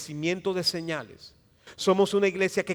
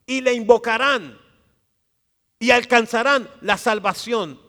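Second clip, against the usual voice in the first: louder, rising pulpit cadence; preacher stirring the church, louder; building sermon; 3 semitones higher, 6 dB louder than usual. Acted. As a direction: build the promise to a peak.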